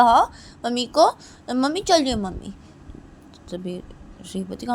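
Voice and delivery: ordinary delivery, nothing marked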